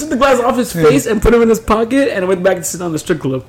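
high laughing narration. Real, not acted. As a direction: tell it while cracking up. Laughing retelling.

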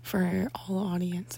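slow hushed whisper. Real, not acted. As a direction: breathe the words softly. Whispered aside about audience.

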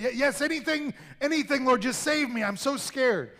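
high, loud, desperate bargaining; frantic pleading. Acted. Man dangling over a drop bargains with God, frantic and high. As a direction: beg and promise anything to be saved.

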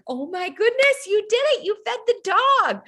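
put-on very high, delighted praise for a toddler; talking-to-kids voice. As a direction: gush as if praising a small child. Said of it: "like, that kid voice".

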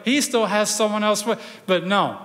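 ordinary talk, nothing marked

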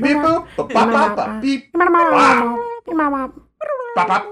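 high squeaky beeping noises; robot beeps. Pretend. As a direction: beep and boop like a cartoon robot. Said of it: "You go ahead and beep".

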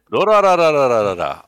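Durararararara rattled off, high and loud with extra ra syllables; rolled out title. Pretend. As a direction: stretch Durararararara into a long rolling trill of ra's. Playful overdone title pronunciation.

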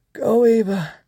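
high, feeble voice; said weakly. A narrator's reading. Swoosh, fallen, sends Ava off, weakly. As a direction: weakly urge her onward. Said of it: "Swoosh said weakly".

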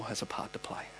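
breathy hushed whisper. Acted. Preacher whispering the closing point to the church. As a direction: let it fall to a whisper.

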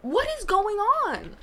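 high, slow, incredulous question; acted bewilderment. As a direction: draw it out in mock disbelief. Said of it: "watch that and be like".

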